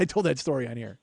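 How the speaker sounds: ordinary delivery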